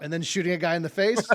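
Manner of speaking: normal talking voice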